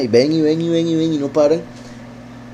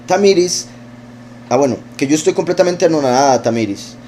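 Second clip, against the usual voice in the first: usual speaking voice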